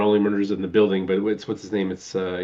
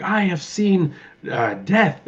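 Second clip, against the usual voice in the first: theatrical Gomez; 8 semitones higher, slower than usual. Retold or imitated. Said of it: "and he's like".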